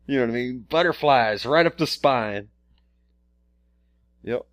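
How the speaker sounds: normal talking voice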